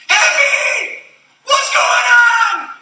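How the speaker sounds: high, loud, delighted shout of welcome; excited greeting. Retold or imitated. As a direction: call out his name like a thrilled mentor. Mentor greets him enthusiastically.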